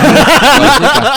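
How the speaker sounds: laughing high and slow; cracking up while repeating. Real, not acted. Laughing reply to joke.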